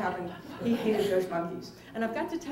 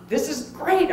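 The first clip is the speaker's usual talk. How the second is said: excited; 7 semitones higher, 7 dB louder, slower than usual. Retold or imitated. Group gets excited.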